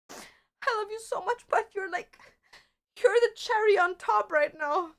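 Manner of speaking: high, sweet coo at the pet. Real, not acted. Talking to her cat in high, sweet baby talk. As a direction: gush over the cat in a high voice.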